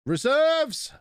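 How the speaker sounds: high, loud battle cry on Reserves!; rallying shout. Shown as a demonstration. Streamer summoning his reserve squad with a loud rallying shout. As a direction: call Reserves! like a superhero summoning the troops.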